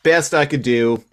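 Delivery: hammed-up dealer offering a lowball; mock haggler. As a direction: sell it like a pushy pawnbroker. Playing pawn shop haggler.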